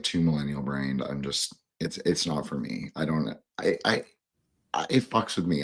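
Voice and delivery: ordinary delivery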